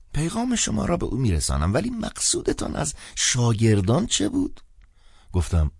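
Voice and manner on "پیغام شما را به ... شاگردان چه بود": put-on old priest. A narrator's reading.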